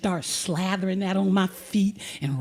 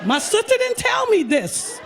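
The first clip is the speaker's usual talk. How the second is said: much higher, louder alarmed cry; hammed-up fright at the setup; mock panic; 11 semitones higher, 3 dB louder than usual. Teller realising her sister warned nothing, mock panic. Nervous, scared at the pedicurist's.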